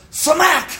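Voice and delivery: loud, high shouted smack sound. Retold or imitated. Trainer striking the elephant, shouted smack. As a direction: yell the smack like a cane blow. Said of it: "takes a cane and goes".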